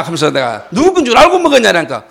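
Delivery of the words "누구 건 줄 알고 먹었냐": high, loud interrogation; angry yell. Retold or imitated. Pastor interrogates chocolate thief, high angry yell. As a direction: yell the question at him. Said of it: "내가 소리 지르고 막 그러다가".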